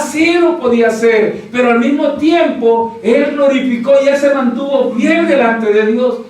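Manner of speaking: loud, high preacher's cry, slow at the start; full-force preaching. Acted. Pastor to the congregation, full-force preaching.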